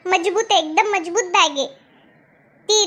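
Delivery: normal talking voice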